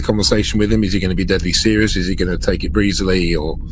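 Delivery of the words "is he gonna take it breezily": ordinary talk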